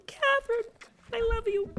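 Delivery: very high, adoring squeal of devotion; high swooning declaration of love; gushing fan. Retold or imitated. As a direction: gush it like a starstruck fan. Gushing fan address to author.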